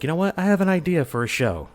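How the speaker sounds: eager, lifted self-quote; retold pitch to friends. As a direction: quote yourself springing an idea on friends. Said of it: "I told them, it's like".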